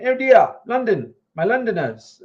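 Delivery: high, hammed-up London accent; bad British accent. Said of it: "my bad British accent".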